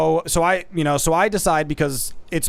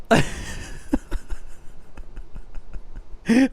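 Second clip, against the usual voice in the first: long breathy wheeze of laughter; soft, helpless giggling; laughing; 4 semitones higher, 5 dB quieter than usual. Real, not acted. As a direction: crack up at the memory. Laughs at hilarious memory.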